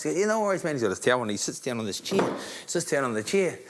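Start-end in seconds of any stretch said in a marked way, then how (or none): 0.2-0.7 easygoing high-pitched champ; Mike Tyson. Retold or imitated. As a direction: answer lightly in an imitated high boxer's voice. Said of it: "Hey, Mike, can I get you".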